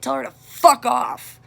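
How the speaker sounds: a mother cursing at the state mommy; slow angry curse. Retold or imitated. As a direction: lean hard on every word in anger. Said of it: "I told her".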